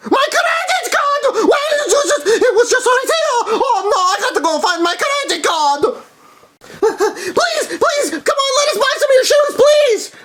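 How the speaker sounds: panicked pleading. Acted. Mario begging the shopkeeper for shoes, high frantic plea. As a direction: beg the shopkeeper desperately.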